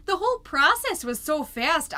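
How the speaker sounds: normal talking voice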